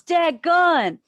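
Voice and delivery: high, loud folksy exclamation; Mater exclaiming. Acted. Ride narrator reacting to the elephant noise, Mater exclaiming. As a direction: blurt it out as a startled narrator. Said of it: "And then he goes".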